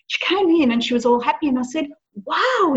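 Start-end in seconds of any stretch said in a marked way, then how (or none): 2.2-2.7 impressed wow. Retold or imitated. Mother praising daughter.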